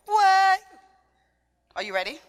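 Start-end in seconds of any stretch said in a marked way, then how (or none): 0.1-0.6 Trinidadian call of "way", high and loud. Shown as a demonstration. Trinidadian dancer teaching a crowd, loud high call. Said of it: "Did you hear?".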